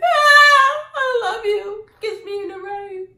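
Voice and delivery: high, loud hammed-up wail; melodramatic lover. Vlogger re-enacting rain reunion with boyfriend, melodramatic lover. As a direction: cry it out like a corny movie heroine. Re-enacts corny romance scene.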